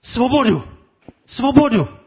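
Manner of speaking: high, forceful cry, falling at the end; punchy high-pitched preacher's shout; rallying roar. Acted. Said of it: "Máme v sobě ten řev".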